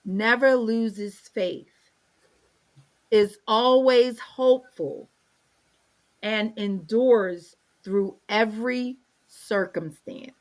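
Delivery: slow, measured reading aloud; unhurried Bible reading; scripture reading. Acted. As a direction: read the verse out deliberately, with pauses.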